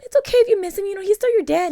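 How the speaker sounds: high, loud mock reassurance; animated, high-voiced consoling; imitated comforting.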